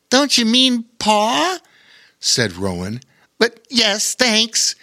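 put-on high cheeky little boy, slow joke; young boy voice. A narrator's reading. Rowan teasing Bear King about paws, high cheeky voice. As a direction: tease the bear gently.

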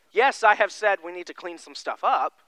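normal talking voice

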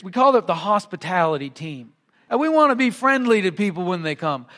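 usual speaking voice

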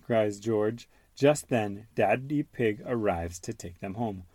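plain reading voice

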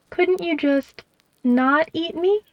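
high, slow small plea; timid plea. Acted. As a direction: ask it high and slow, hopeful. Mouse pleads with the owl.

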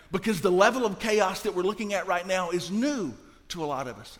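usual speaking voice

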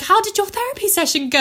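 lightly voiced chirpy mum. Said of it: "my mum was like".

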